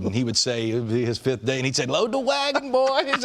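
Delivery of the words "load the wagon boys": high, drawling country Mississippi twang; Roy Oswalt's Mississippi twang. Retold or imitated. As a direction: do a Southern Mississippi pitcher calling his day. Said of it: "in that country Mississippi twang".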